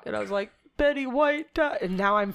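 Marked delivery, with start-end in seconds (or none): 0.8-1.8 high, slow mock wail; retold crying. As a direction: imitate wailing out bad news. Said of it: "full bl-, blown crying".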